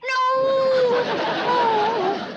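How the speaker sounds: loud, high wail of dismay; Granny's loud, high sympathetic wail; sympathetic cry. Acted. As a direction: cry out in alarm for her.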